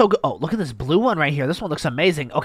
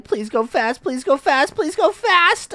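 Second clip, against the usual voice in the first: pleading; 10 semitones higher, slower than usual. Real, not acted. Begging the slide.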